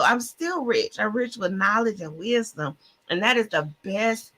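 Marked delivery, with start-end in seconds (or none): none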